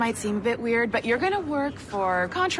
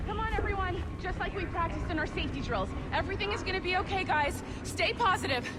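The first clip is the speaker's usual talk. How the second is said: calming a crowd; 8 semitones higher, 5 dB quieter than usual. Acted. Calming staff during emergency.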